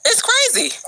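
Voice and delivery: high falling "crazy", amazed; high incredulous. Real, not acted. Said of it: "repeats crazy for emphasis".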